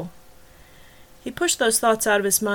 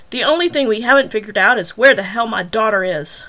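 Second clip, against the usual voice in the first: much louder, bursting out; furious father; 8 dB louder than usual. Acted. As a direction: let the rage break through. After slamming fists on table.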